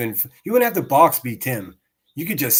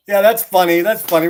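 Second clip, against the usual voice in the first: loud, higher mocking laugh into the words; sarcastic laughing; 7 semitones higher, 9 dB louder than usual. Real, not acted. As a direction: laugh him down, scornfully.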